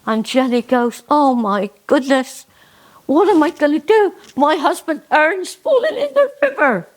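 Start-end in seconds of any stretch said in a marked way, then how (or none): 1.1-6.9 a high, hammed-up panic; panicked. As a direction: squeal in alarm as the husband falls in. Jenny panics, husband fell in.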